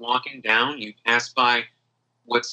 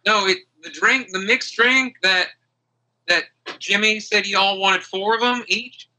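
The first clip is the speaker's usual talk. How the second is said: higher-voiced, earnest friend; explaining Aggie; 7 semitones higher than usual. Friend explaining the mixed drink to the forgetful hero, earnest. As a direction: explain haltingly in a lifted character voice.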